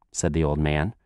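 ordinary delivery, nothing marked

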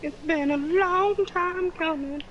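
sing-song drawl. Pretend. Drawn-out echo of friend.

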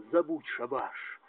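ordinary talk

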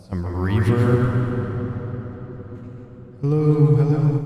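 reverb. Shown as a demonstration. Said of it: "I can also add some".

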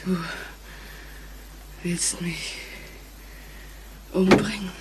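soft, low, fearful whisper; terrified whisper. Acted. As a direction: dread in the voice. Terrified realisation.

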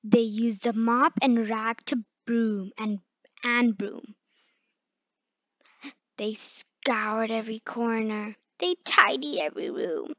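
plain reading voice

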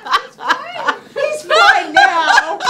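very high, slow laughing yell; sarcastic laughing shout. Real, not acted. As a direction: drag it out while laughing. Dog still chewing the leash.

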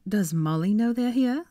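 icy and measured, climbing at the end; cold. A narrator's reading. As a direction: ask it coldly, drawing the words out. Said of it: "she asked coldly".

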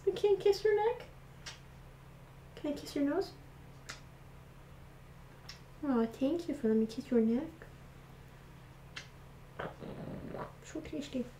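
doting owner sweet-talking her rabbit. Real, not acted. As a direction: sweet-talk the bunny up high. Cooing to pet rabbit.

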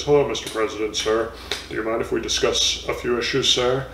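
a hint of a stiff, earnest reporter; mock-serious polite interviewer, lightly voiced. Imitating the serious reporter greeting the president, lightly voiced. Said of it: "he's got his very serious voice".

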